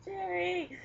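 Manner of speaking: high laughing yelp; laughing cry. Real, not acted. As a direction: squeal his name mid-laugh. Laughing protest at partner.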